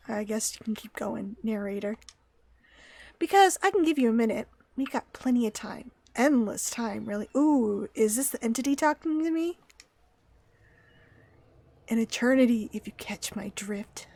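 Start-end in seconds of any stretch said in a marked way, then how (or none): 0.1-2.0 hushed unvoiced aside to the narrator; whisper. Real, not acted. Player whispering back to the game narrator, hushed. 12.7-14.1 whisper. Pretend. Sly aside to the narrator.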